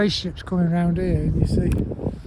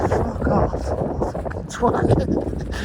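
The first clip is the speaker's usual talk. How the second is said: mock angry retort; 6 semitones higher, much slower than usual. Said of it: "Simon's first thing is to go".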